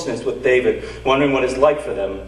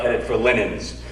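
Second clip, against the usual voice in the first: ordinary talk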